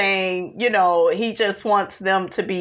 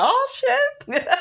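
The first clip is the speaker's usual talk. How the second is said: high, breathless oh shit; shocked; 14 semitones higher, 4 dB quieter than usual. Retold or imitated. Retelling her shocked reaction to the show, high pitched. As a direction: gasp it out in shock. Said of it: "I was like".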